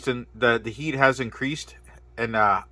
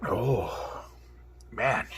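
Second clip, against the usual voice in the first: whispered; 8 dB quieter than usual. Real, not acted. Reeling from spicy heat.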